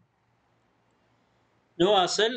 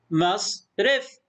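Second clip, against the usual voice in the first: slow مصرف as "mas ... ref"; split into syllables; 2 semitones higher, much slower than usual. Shown as a demonstration. Teacher sounds out the word, syllable by syllable. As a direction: pause inside مصرف after the s. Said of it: "مقطع ساكن".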